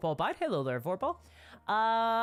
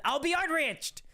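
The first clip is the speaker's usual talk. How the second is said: higher-pitched, playful indignant outburst; mock outrage; 8 semitones higher than usual. Streamer joking to viewers about his outrage, mock outrage. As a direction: declare it in hammed-up indignation. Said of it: "like, outraged all the time".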